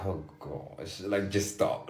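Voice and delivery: quiet, frustrated grumble. Retold or imitated. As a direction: sigh it out under your breath. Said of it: "so frustrated".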